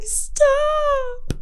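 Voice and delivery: put-on very high, drawn-out little-kid stop; mock whine of a little kid; whiny kid voice. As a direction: imitate a five-year-old pleading. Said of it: "this five-year-old that was like".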